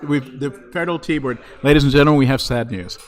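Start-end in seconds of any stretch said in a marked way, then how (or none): 1.6-3.0 loud mock press announcement; booming formal announcement, deadpan; announcer voice.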